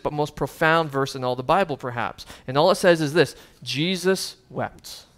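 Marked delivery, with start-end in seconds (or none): 3.6-5.1 solemn slow reading. Acted. Reads the shortest verse solemnly.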